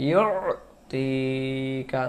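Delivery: ইউতিকা with a long stretched ইউ; slow Quranic drawl on ইউতিকা; drawn-out recitation. Shown as a demonstration. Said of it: "এখানে ইউতি টান না দেন".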